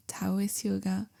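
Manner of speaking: ordinary delivery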